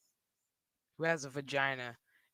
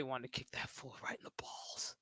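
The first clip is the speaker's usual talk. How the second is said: whispered confession; 4 semitones lower, 11 dB quieter than usual. Real, not acted. Streamer confessing to chat, in a whisper. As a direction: let it out under your breath.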